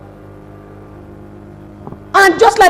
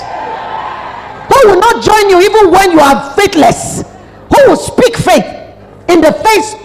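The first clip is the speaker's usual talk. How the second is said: rising preaching; 5 semitones higher, slower than usual. Real, not acted. Preacher's blessing rising to a shout.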